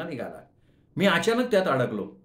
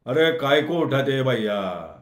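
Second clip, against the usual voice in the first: slow, louder, drawling challenge in a put-on accent; Bombay Hindi passenger; 4 dB louder, slower than usual. Stranger questions the narrator lifting his tin, slow and louder. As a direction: ask why he's taking your box. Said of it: "असा आवाज आला".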